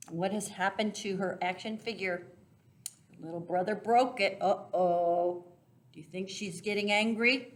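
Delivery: usual speaking voice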